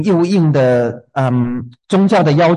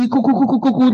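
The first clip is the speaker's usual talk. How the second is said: imitated hen clucking; 8 semitones higher, faster than usual. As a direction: cluck like a hen calling her chicks. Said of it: "温柔慈声的那种呼唤". (模仿母鸡咕咕呼唤)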